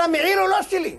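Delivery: high, insistent denial; mimicked disclaimer. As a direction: echo the other man's disowning. Mimics second disclaiming litigant.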